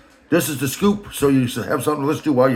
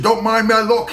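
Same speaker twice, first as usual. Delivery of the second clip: much higher mocking boss voice, louder; Rand's voice; 8 semitones higher, 4 dB louder than usual. The boss introducing himself, in a high mock voice. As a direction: ham up the boss. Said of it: "I'm just filling in voice".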